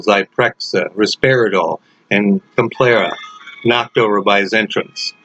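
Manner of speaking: ordinary delivery, nothing marked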